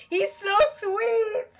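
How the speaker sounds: very high, loud, slow fangirl squeal; gushing squeal. Real, not acted. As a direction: squeal it like you're melting. Gushing over the game character.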